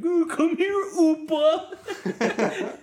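put-on very high, loud squeaky baby voice; mock cutesy calling; mocking pet talk. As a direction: coo at him mockingly. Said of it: "had his ear pressed up to my door".

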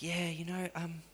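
hushed, needling accuser; the accusing devil on the phone to Liam, hushed; soft accusing voice. Retold or imitated. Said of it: "It's like".

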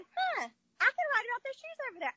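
"Huh" like a lightbulb just went on; mock eureka as she retells it; sudden idea. Retold moment of realization, repeated.

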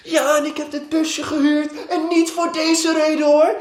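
high, loud mock shriek of a complaining woman; imitating a screaming woman. Retelling the woman with the rented van, in a high, loud mock shriek. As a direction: squeal it out like an indignant renter. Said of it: "ze is aan het schreeuwen van".

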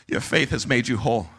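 hushed, low-voiced blessing; Jesus. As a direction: say it calmly and tenderly. Jesus pronounces healing.

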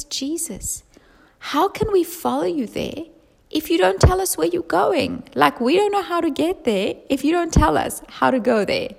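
pleading disciple. Acted. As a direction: plead like a confused follower. Thomas voiced pleading for answers.

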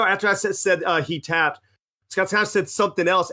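usual speaking voice

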